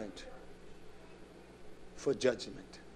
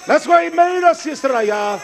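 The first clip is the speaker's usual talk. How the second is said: high, ringing holler to the congregation; full-voiced pulpit shout; preaching shout; 13 semitones higher, 15 dB louder than usual. Real, not acted. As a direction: cry it out loud and high.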